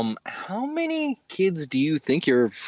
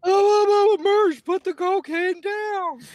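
very high, loud cartoon wail; cartoon character; 5 dB louder, slower than usual. Cartoon sidekick begging Mersh to put it down, squealing. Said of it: "talking like a cartoon character".

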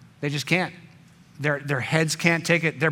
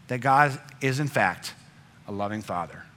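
softer and lower, tender close; 7 semitones lower, 4 dB quieter than usual. Real, not acted. Preacher telling the congregation God loves them, tender and hushed. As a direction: let it fall soft and lower, lovingly. Sermon climax.

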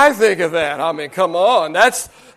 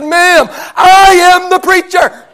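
insistent protest; 7 semitones higher, 3 dB louder than usual. Retold or imitated. Young preacher insisting to church lady.

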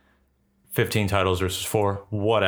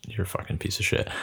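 whispering; 5 dB quieter than usual. Acted. Playful insult, whispered.

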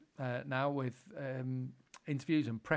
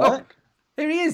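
excited greeting; 13 semitones higher, 11 dB louder than usual. Real, not acted. Greeting the arriving caller.

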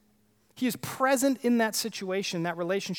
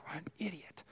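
under the breath, much softer; muttered insult; 3 semitones lower, 16 dB quieter than usual. Retold or imitated. Preacher imitating someone muttering an insult, much softer. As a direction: mutter it like a contemptuous aside.

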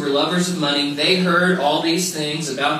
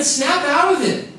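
mock wake-up call; 9 semitones higher than usual. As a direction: jolt yourself awake, half joking. Heart tells him to snap out.

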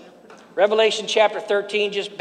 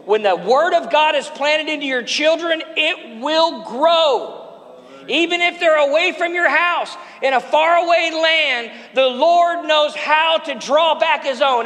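preaching at full force; 6 semitones higher than usual. Acted. Preacher building to a shout over the congregation.

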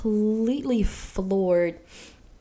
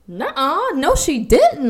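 high-pitched retold disbelief, louder at the end; disbelieving denial; 8 semitones higher, 6 dB louder, slower than usual. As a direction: act out refusing to believe it. Retold refusal to believe the news.

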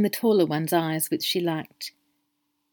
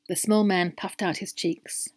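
ordinary delivery

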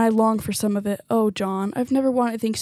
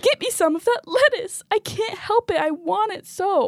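tearful pleading; 7 semitones higher than usual. Acted. Wife begs through tears.

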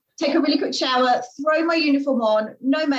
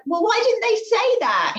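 indignant squeak; 11 semitones higher than usual. Retold or imitated. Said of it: "I'm like".

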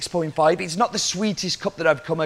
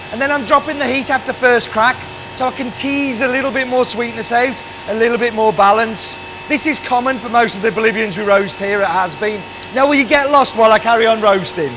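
loud, higher-pitched working voice; raised voice; 7 semitones higher, 6 dB louder than usual. Real, not acted. Roaster explaining his method mid-roast, in a loud raised voice. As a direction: project firmly while busy roasting.

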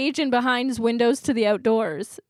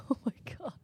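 breathless giggly whisper; laughing whisper; 14 dB quieter, much faster than usual. Real, not acted. Laughing whisper after gross joke.

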